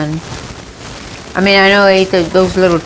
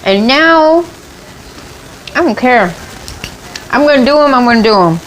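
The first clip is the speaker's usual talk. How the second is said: defiant; 6 semitones higher than usual. Real, not acted.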